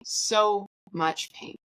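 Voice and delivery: so much pain stretched out slowly; slow, drawn-out echo. Retold or imitated. Patient recalling failed IV attempts, slowly echoing herself. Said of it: "Now I'm crying".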